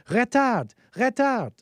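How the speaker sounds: mock alarm, high and loud; yelling French cockpit warning. Plane controls telling the pilot to slow down, yelled French warning. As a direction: bark it like an automated French warning. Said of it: "it'll yell at you to slow down like that".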